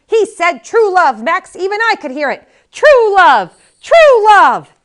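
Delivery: put-on very high, loud insistent old woman; shrill old wife. A narrator's reading. Valerie shouting true love at Max, high and loud. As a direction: hammer him with true love.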